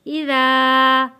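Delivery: इज़ा with the ज़ा drawn out long; slow recitation holding the alif in इज़ा; stretched Quranic reading. Shown as a demonstration. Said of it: "हरूफे मद्दा है".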